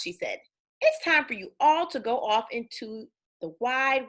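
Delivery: put-on lively read-aloud for kids, bouncy tune; storybook reading. A narrator's reading. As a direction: read it like bedtime story time.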